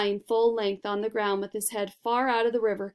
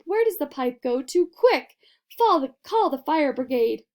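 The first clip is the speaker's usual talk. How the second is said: yelling; 5 semitones higher, 6 dB louder than usual. A narrator's reading. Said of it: "yelled Missus Gloop".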